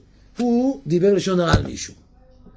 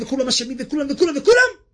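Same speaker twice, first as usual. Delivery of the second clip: rising sarcastic crescendo; mounting mock outrage; 9 semitones higher, 3 dB louder than usual. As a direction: voice climbing higher with each repeat. Preacher mocking blaming everyone.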